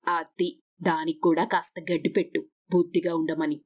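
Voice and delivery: low-pitched grumbling neighbour voice; scolding neighbour. A narrator's reading. Neighbour leaving after a quarrel, low scolding. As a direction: scold them as you leave, pitched well down. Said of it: "Saroja అలా తిట్టుకుంటూ వెళ్ళిపోయింది".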